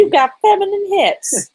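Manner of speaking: high, loud mock kid voice; jeering boy. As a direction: heckle in a squeaky put-on voice.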